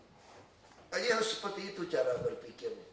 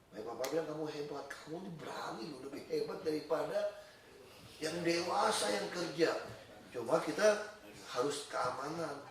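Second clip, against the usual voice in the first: gentle fatherly advice; 2 semitones lower, 7 dB quieter than usual. Retold or imitated. Father to son safely down from the tower, gentle advice. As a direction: reassure softly while cautioning.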